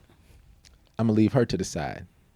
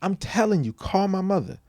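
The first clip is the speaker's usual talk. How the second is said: mock yell, much higher and louder; retold loud warning to teachers; 10 semitones higher, 6 dB louder than usual. Mom to teachers, a loud imitated warning. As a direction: hammer the words call my mother at them. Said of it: "I would tell teachers".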